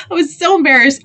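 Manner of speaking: high, loud comic mortification; embarrassed exclamation. Retold or imitated.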